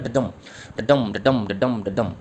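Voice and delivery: "डडम" beaten out with the stress on the final "डम"; iambic rhythm demo. Shown as a demonstration. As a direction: chant "डडम" as short unstressed "ड", strong "डम". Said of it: "इस तरह से rhythm चलती है".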